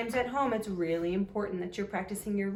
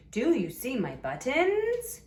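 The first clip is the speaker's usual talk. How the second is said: slow question, BUTTONS rising high; pitched-up model question climbing at BUTTONS; lilting upward on BUTTONS; 5 semitones higher, 3 dB louder than usual. Shown as a demonstration. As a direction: let the voice lilt up on BUTTONS. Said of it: "You hear my voice lilting f-, upward".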